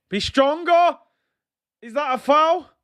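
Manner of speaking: high, loud urging shout; real yell. Real, not acted. Fan yelling at Tavares on screen, loud urging shout. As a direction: yell at the defender to toughen up.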